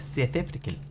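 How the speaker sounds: put-on quiet South African accent, vowels shifted; put-on South African twang. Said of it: "I recognized her accent as South African".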